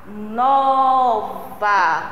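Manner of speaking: NOVA with a long held first syllable NO; "nova" called out slowly, stress on NO; stressed syllable drawn out. Shown as a demonstration. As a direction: stretch the NO in "nova", then let VA fall. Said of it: "Vamos pronunciar a palavra".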